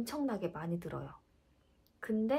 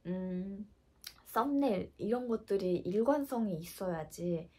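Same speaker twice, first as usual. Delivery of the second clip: usual speaking voice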